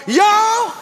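loud, slow, drawn-out Baltimore call; preaching the woman's cry Baltimore-style. Acted. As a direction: call it out like you're back in Baltimore. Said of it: "Can I say it like I'm in Baltimore?".

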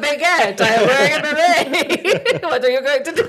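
put-on hammy French accent, laughing through it; laughing cartoon French skunk voice; Pepe Le Pew. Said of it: "That was Pepe Le Pew".

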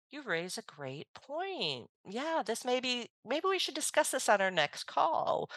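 acted diplomatic niceness, gentle and lifted; super nice, friendly. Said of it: "that's super nice and friendly".

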